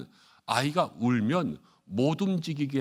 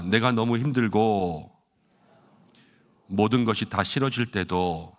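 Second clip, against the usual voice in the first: slow, measured line for a crowd to echo; repeat-after-me; 4 dB louder, slower than usual. Shown as a demonstration. Pastor leads congregation in saying it after him, slow and deliberate. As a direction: stretch each phrase out for repeating. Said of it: "한번 따라해 보겠습니다".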